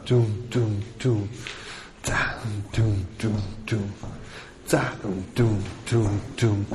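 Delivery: low, soft vocal bass off the beat; low quiet dum dum on the offbeat; offbeat bass imitation. Shown as a demonstration. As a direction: hum the bass against the rhythm. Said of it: "die Betonung so ein bisschen gegen den Rhythmus".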